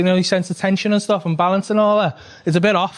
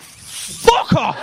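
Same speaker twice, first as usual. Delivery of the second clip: exasperated outburst. Retold or imitated. Exasperated while slamming son.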